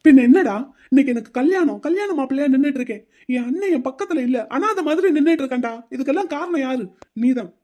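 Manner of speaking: sharp opening burst, then bitter complaint; angry outburst. Acted. Groom lashing out at his friend on the wedding day, angry. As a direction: blame him to his face.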